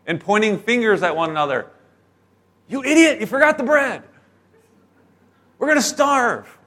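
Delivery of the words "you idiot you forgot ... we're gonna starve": mock bickering disciples. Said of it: "they just start arguing".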